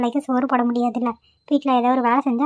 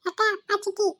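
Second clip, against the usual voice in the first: put-on higher, softer baby-talk whimper; whimpering child; 7 semitones higher, 5 dB quieter than usual. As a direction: say it like a hungry toddler. Child whimpering hungry to sister.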